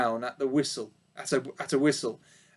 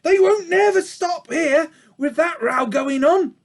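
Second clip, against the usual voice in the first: high, louder bawling ewe; old sheep bawling; 11 semitones higher, 5 dB louder than usual. A narrator's reading. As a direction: bawl it at the piglet as old Ma. Ma shouts while running.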